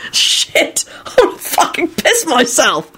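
high, strained outburst; shouting in hysterics. A narrator's reading. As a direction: gasp it out while rolling about. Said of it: "shouted and began rolling around".

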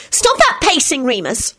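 high, sharp snap; snapping. Acted. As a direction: snap it at him. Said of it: "Solange snapped".

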